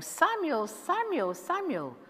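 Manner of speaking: high, drawn-out name call. Acted. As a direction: call out to wake a sleeping boy. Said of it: "hears the voice going".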